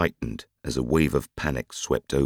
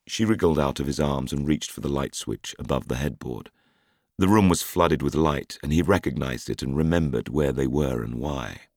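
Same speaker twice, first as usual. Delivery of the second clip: plain reading voice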